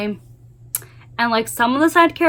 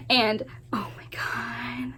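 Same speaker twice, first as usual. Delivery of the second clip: breathless whisper; 13 dB quieter, slower than usual. Real, not acted. Overwhelmed by romance scene.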